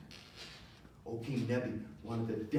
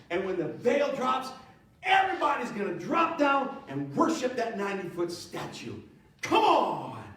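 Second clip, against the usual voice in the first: higher and louder, building to a shout; building hype; 6 semitones higher, 4 dB louder than usual. Acted. Preacher to church, hyping the king's big unveiling, shouting. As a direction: announce it like a hype man, rising.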